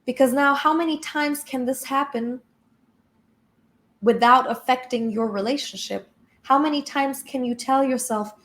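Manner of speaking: ordinary delivery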